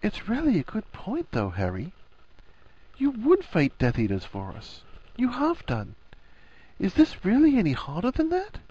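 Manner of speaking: high, soft woman's character voice; Hermione, gentle reasoning. A narrator's reading. Hermione coaxing her hesitant friend, gentle. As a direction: reason softly, lilting up high.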